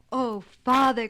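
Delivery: loud, eager cry to his father; loud happy outcry; excited cry. A narrator's reading. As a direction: burst out with joy, loud. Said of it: "cried Jasper".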